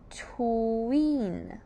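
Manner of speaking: "Tween" drawn out slowly through each sound; "Tween" slowly elongated; stretched syllable. Shown as a demonstration. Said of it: "the second syllable here is tween".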